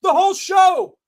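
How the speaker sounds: high, loud exasperated yelling; real outraged shout. Real, not acted. Host rants loudly about Shawn tossing photographer. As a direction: shout it in disbelief. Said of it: "stupidest thing".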